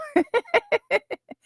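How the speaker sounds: high burst of laughter; real laugh. Real, not acted. Laughing at her own impression.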